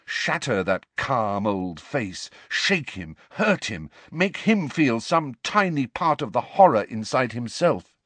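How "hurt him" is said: usual speaking voice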